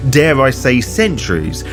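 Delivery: ordinary talk, nothing marked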